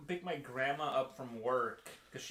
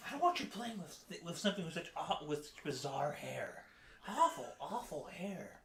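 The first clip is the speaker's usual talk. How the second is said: mock scolding parent; 2 semitones higher than usual. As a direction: scold like a disapproving mom. Voicing a disapproving parent figure.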